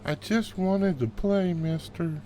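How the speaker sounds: put-on high, sweet little girl pleading. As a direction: play an innocent small girl asking to play.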